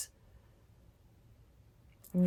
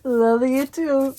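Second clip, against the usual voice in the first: drawn-out love you too; 8 semitones higher, 7 dB louder, slower than usual. Acted. Echoing her own quoted reply.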